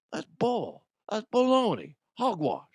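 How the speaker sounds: high, indignant put-on voice; bad impression. Host quotes a protest in a terrible impression, high hammed-up voice. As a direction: scoff in a hammy high voice. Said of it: "that impression again 'cause it was terrible".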